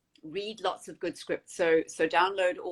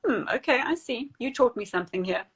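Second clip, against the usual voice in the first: mock grudging acceptance; 7 semitones higher than usual. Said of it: "look at it and go".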